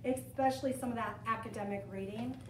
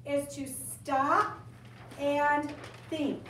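slow deliberate; much slower than usual. Shown as a demonstration. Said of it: "The last thing".

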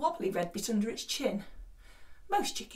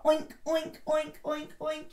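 pig oinks; 6 semitones higher, 3 dB louder than usual. Shown as a demonstration. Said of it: "Can you do a pig noise".

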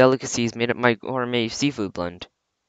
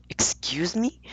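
indignant; 6 semitones higher, 5 dB quieter, slower than usual. Acted. Cat insulted by girl.

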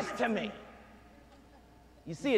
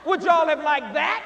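ordinary talk

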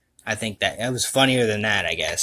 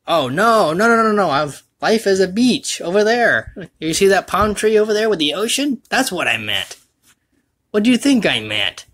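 mock defensive protest; 9 semitones higher, 5 dB louder than usual. Mock defending his pun.

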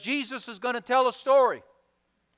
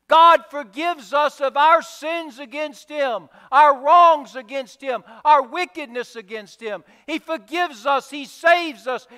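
louder, rising preacher's cadence, each line pressed harder; preaching build; 3 semitones higher, 5 dB louder than usual. Acted. Pastor drives home God's forgiveness to the congregation, preaching louder. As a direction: preach it, stacking each phrase louder to a climax.

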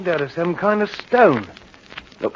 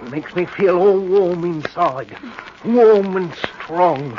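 slow, drawn-out words; spellbound relish; slower than usual. Acted. Henry feeling the knife's power, slow and spellbound. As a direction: stretch it out as the power rises.

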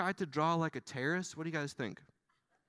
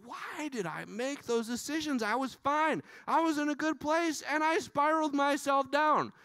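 higher, wistful self-questioning; regretful inner voice; 8 semitones higher than usual. Retold or imitated. To the congregation, voicing our own regret, pitched higher. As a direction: voice the regret of looking back. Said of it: "we look back and we go".

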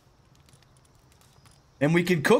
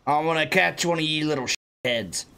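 ordinary talk, nothing marked

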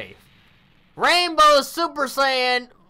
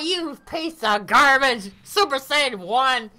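ordinary talk, nothing marked

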